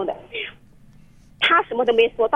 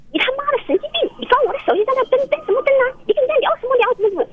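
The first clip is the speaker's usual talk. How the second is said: high-pitched mock yell, faster and sharper; retold angry yelling; 14 semitones higher than usual. Wife on the radio imitating her husband cursing at her, high mock shout. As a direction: voice the furious husband much higher, pushing.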